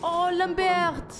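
slow, high, hammed-up French cry; imitating a game character's French accent, slow and high; mock French accent. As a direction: drawl Lambert's name like a bad French soldier. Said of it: "Lambert can't decide what, uh, accent to use".

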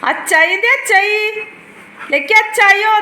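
high cooing call; high sing-song. Pretend. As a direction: coo up high to the dog. Answering as daddy, high.

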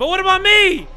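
high, loud, pleading "But what about me?"; whiny protest. Pretend. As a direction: beg not to be sent alone. Worried about being left alone.

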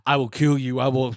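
mock a killing machine's threat, a little low; hint of a menacing robot; robot executioner. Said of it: "robot executioner's just talking about".

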